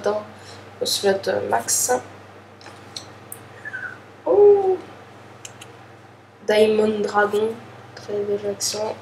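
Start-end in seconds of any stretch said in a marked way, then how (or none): 4.3-4.8 high, loud "Oh" of surprise; surprised oh. Real, not acted. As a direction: react to a great card. Card reveal while opening a pack.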